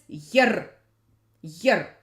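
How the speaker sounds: "yer" ending in a hard rolled r. Shown as a demonstration. Teacher names the hard mark, hard r in "yer". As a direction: say "yer" with a firm trill, no ee colour. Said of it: "It's hard".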